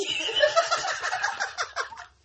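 laughter near tears; high wheezing laugh. Real, not acted. Laughing fit continues.

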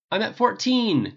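crowing. A narrator's reading. Tony bragging his kill count to the archer, crowing. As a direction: crow about the score, slowly savoring it. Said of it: "Tony crowed".

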